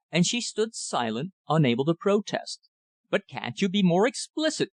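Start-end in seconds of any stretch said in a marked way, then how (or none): 3.1-4.7 rising anger. A narrator's reading. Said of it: "I cried, my anger rising".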